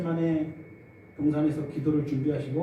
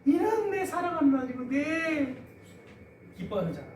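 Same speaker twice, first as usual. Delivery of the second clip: higher, proud voice on 이는; God proudly announcing his son to the crowd, higher; proud boasting; 10 semitones higher than usual. Retold or imitated. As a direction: boast about a beloved son. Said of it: "막 자랑하는 거예요".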